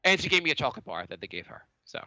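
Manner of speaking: ordinary delivery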